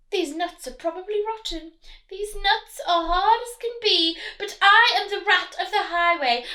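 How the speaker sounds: high, loud mocking rat; sneering rat. Highway rat mocking the squirrel's nuts, high and loud.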